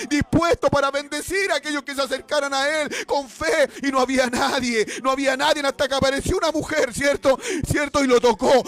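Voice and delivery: high, loud preaching of the healing story; full-force preaching. Acted. Preacher retelling the healed woman, loud and high. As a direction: preach it with rising fire.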